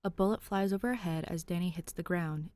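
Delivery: usual speaking voice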